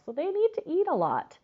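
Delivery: ordinary delivery, nothing marked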